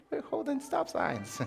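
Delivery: comically high, quiet admission; brother calling back. Retold or imitated. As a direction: answer in a small high put-on voice. Brother's sheepish reply acted out.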